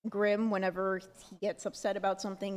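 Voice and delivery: ordinary talk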